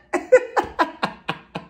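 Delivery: breathy burst of real laughter. Real, not acted. Cracking up at her own joke, real laughter. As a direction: laugh at your own Nene Leakes joke.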